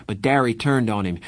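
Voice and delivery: ordinary delivery, nothing marked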